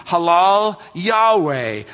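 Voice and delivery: slow, Halal Yahweh as huh-LAHL YAH-way; spelling out hallelujah. Shown as a demonstration. Preacher breaking hallelujah into its Hebrew parts, slow and careful. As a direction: sound out Halal Yahweh slowly, word by word.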